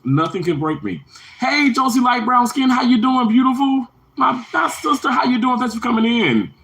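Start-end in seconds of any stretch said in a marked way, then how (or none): 1.4-6.5 high, gushing welcome; excited greeting. Real, not acted. Streamer welcoming his sister Josie in, excited greeting. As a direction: call out a delighted hello, way up high.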